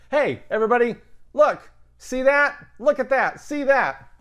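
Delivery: high and loud, rallying the class; excited call-out. Real, not acted. Teacher calling the class to look, excited and loud. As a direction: grab everyone's attention, up and loud.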